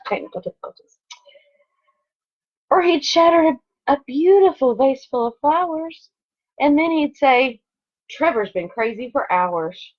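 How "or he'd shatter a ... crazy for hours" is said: lively storybook read-aloud; expressive picture-book narration; reading to children. Acted. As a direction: read it like story time for second graders. Reads picture book to class.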